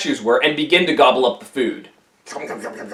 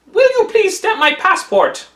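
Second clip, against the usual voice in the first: much higher, meek request; polite monk; 10 semitones higher than usual. Acted. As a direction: ask sweetly like a humble monk. Tripitaka asks politely.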